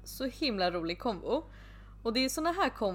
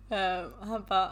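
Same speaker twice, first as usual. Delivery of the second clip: normal talking voice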